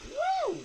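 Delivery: a sudden, very high whoop of delight; high delighted woo. Real, not acted. Reviewer thrilled by the tiny toy laptop, squealing woo. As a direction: let out a joyful squeal.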